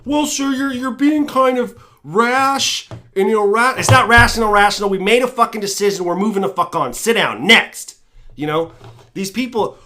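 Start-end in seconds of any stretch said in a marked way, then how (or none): 0.0-3.7 high, stretched-out protesting voice; put-on high voice of polite complaint; mock objector. Plays objector questioning him as politician. 3.8-8.0 loud, raised-pitch acted dismissal; mock politician losing patience, loud; brash cut-off. Politician cuts objector off.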